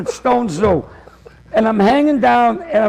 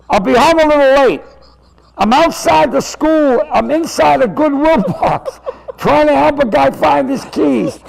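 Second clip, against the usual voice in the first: phone call from the box; 4 semitones higher than usual. Retold or imitated. Man in the Goodwill box phones his wife, raised voice. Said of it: "I hear him on the cell phone".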